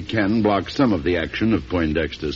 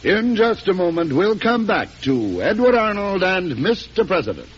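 bright, higher announcer read; radio announcer; 10 semitones higher than usual. Acted. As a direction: tease the next segment like a radio host. Announcer break.

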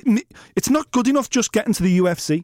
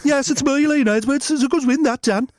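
light Brummie fighter voice, a touch higher; Brummie impression; 5 semitones higher than usual. Leon Edwards thanking Dan in a light Brummie accent. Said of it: "impersonate the hardest men".

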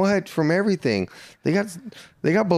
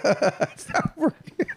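laughing mock echo, high and slow; slower than usual.

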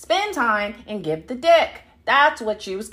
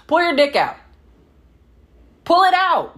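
demanding; 8 semitones higher, 5 dB louder than usual. Retold or imitated. Demanding partner pull out.

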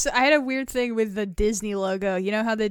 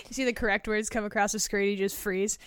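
usual speaking voice

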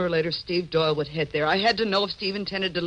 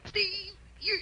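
high, sobbing protest; crying out in pain; slower than usual. Acted. Adrian begging Steve to stop hurting her, crying. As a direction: cry out as he hurts you.

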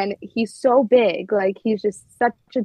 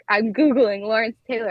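ordinary talk, nothing marked

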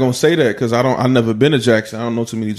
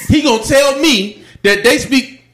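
incredulous; 8 semitones higher, slower than usual. Real, not acted. Friend incredulous at S0's claim.

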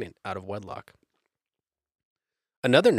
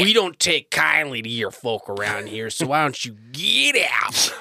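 mock hostile local; 2 semitones higher, 3 dB louder than usual. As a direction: warn off strangers, mock menace.

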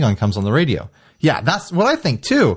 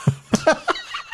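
laughing; 5 dB quieter than usual. Real, not acted. Laughing at DMV joke.

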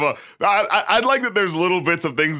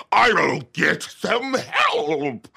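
hammed-up, high, drawn-out cry for help; cartoonishly melodramatic slow wail; over-exaggerated acting; 8 semitones higher, much slower than usual. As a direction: overact it wildly, stretching every word. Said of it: "over-exaggeratedly".

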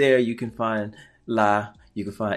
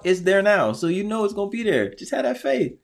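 fired-up, high-pitched exhortation; rousing preacher's encouragement; preaching; 6 semitones higher, 4 dB louder than usual. Real, not acted. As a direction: preach it to her with force. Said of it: "watch you preach to me".

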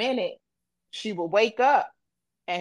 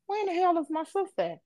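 high, fast, worried outburst; fretting aloud where her sister went; alarmed; 10 semitones higher, faster than usual. Retold or imitated. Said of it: "I'm like".

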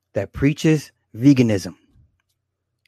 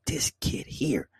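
put-on slow whispered exasperation at the kid; muttered whisper; 2 semitones higher, 10 dB quieter, slower than usual. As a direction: breathe it out, shaking your head. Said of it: "I'm just like".

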